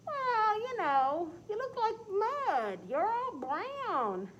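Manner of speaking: high, slow, catty drawl; cat character voice. Acted. Cat teasing the turkey about his color, high slow catty voice. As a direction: stretch it out like a sly cat.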